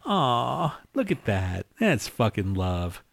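lightly voiced cooing fan; mock sappy coo. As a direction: imitate a sappy moviegoer. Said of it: "and just go".

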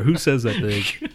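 ordinary talk

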